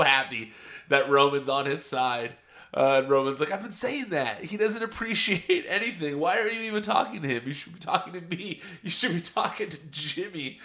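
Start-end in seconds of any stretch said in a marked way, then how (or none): 3.5-10.6 higher, teasing Roman impression, picking up speed; mock Roman egging Sami on against Jey; imitating Roman. Roman telling Sami to talk to him, not Jey, in a higher mocking voice.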